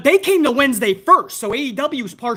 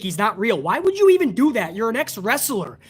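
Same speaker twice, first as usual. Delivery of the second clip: ordinary talk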